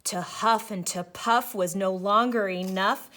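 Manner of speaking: usual speaking voice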